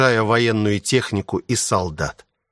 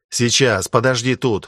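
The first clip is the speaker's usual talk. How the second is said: ordinary delivery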